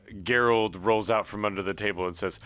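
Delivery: ordinary talk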